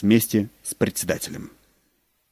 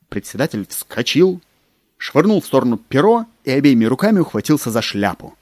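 usual speaking voice

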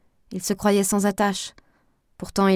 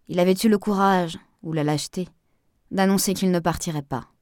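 plain reading voice

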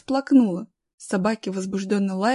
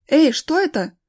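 high, loud, startled question; acted shout of alarm; sudden shout; 6 semitones higher, 7 dB louder than usual. A narrator's reading. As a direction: cry out as if spotting something. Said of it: "крикнул вдруг дядя Вова".

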